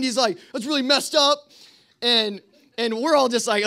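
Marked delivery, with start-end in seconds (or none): 0.5-1.4 high, indignant mock scolding; retold angry yell. Night watchman rebukes cabin boys, imitated high indignation. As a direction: tell them off in a pitched-up voice. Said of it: "if he just yells at us".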